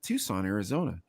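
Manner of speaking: low, hushed classical announcer; classical radio voice. Shown as a demonstration. Showing how he sounded on classical radio, low soft announcer. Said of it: "and I sounded like this".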